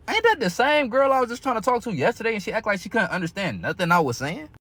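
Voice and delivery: high, stunned disbelief at what he sees; incredulous disbelief. Retold or imitated. Retelling how he spotted her, high and incredulous. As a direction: pitch it way up in shock. Retold shock at the scene.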